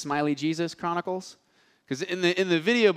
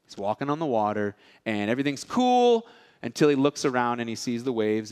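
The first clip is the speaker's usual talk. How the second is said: usual speaking voice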